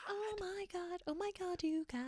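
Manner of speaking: breathless hushed fangirl excitement; hushed squeal. Pretend. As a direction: squeal under your breath in bed. Acting listener's silent squeal.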